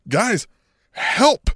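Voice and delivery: high, rising cry for help; a captive begging; mock plea for help. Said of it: "It was like".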